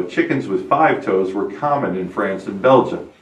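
ordinary talk

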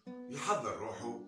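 usual speaking voice

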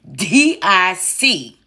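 each letter slow and separate; spelled out slowly. Shown as a demonstration. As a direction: spell it out like a lesson. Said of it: "my diction class".

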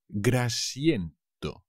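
Grasiento said slowly, each syllable clear; slow model word. Shown as a demonstration. Teacher slowing Grasiento down for students. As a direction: draw out Grasiento for learners. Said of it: "teacher models the word slowly".

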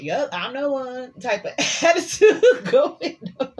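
high, sassy, laughing "yup I'm the one"; sassy attitude. Pretend. Tarot reader to viewers, sassy laughing voice. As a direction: strut it out, laughing at yourself. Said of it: "don't judge me how I just did that".

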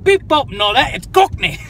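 loud, high imitation Cockney; squawked fake Cockney; mock Cockney. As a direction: imitate a terrible Cockney accent, hammed up. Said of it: "supposed to do the accent".

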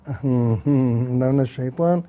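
drawn out, slow and sarcastic; slow mocking repeat. Shown as a demonstration. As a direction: savour each word, slowly.

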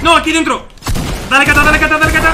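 high-pitched, excited combat callout; urgent urging. Real, not acted. Urging teammate during a fight. (arengando con urgencia, voz aguda)

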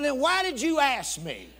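high, loud, drawn-out comeback; indignant, stretched-out retort; exasperated. Retold or imitated. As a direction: protest slowly in comic disbelief.

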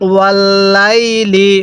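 slow ওয়াল্লাইলি with held l and long লাই; read-along recitation. Shown as a demonstration. Teacher has learners repeat after them, slow drawn-out ওয়াল্লাইলি. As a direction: stretch the middle of ওয়াল্লাইলি evenly. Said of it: "পড়ুন আমার সাথে".

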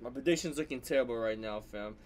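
low, quiet grumbling; dejected. Real, not acted. Fan regretting his prediction, low and deflated. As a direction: lose heart and drop the volume.

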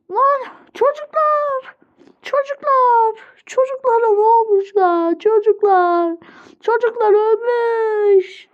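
high, drawn-out mock wailing; play-acted anguish. Teacher finding the children dead, high grieving wail. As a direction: wail for them slowly, high and hammed-up.

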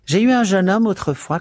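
high, loud character voice; chatty cleaning woman. Acted. Missus Biggs reminiscing to Zipser, chatty and high-pitched.

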